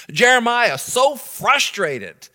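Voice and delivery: normal talking voice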